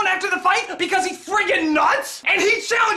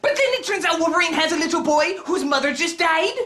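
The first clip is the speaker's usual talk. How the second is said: ordinary talk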